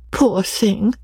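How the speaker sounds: hint of a wistful companion; lightly voiced sympathetic Briggs. A narrator's reading. Briggs thinking of her old writing master, wistful pity. Briggs pities while remembering lost love.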